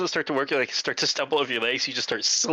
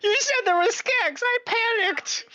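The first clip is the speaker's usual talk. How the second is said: squeaky high panicked worker; high, slow panic; panicky high voice; 3 dB louder than usual. Acted.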